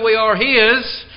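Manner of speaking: HIS slow and drawn out; emphasis on HIS. Real, not acted. Preacher telling brothers and sisters whose they are, emphatic on HIS. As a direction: linger on HIS, then pause. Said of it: "I'm placing the emphasis".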